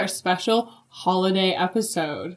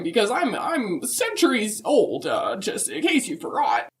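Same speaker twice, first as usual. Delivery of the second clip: put-on higher, sweet old lady voice; Mrs Claus voice; 4 semitones higher than usual. Answering the podcast hosts as Mrs Claus, higher and sweet. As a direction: play a chirpy grandmotherly Mrs Claus.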